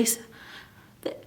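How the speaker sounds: ordinary delivery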